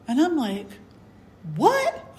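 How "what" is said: much higher disbelieving yelp; a kid baffled there's no monster; surprised. Retold or imitated. Child finds nothing behind door.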